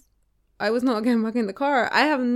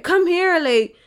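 imitating a pushy date, louder and higher; raised-voice mock demand, pressing and frustrated; exasperated demand; 5 semitones higher, 4 dB louder than usual. Woman mimics date who grabbed her arm, exasperated demand.

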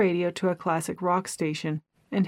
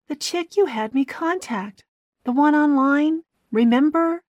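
high, loud, exasperated; exasperated Gina; 9 semitones higher, 4 dB louder than usual. A narrator's reading. Gina reminds Keith about the online woman, exasperated.

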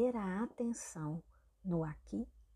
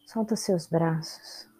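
unhurried meditation guide with long pauses; calm instructions in short, spaced-out phrases; guided meditation; 10 dB louder than usual. Real, not acted. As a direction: guide them through the body, leaving space after each instruction.

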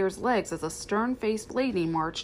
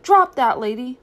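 loud scolding order, acted; sharp command; 4 semitones higher, 10 dB louder than usual. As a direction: order the dog to drop it, much louder. Lady quotes owner's sharp command.

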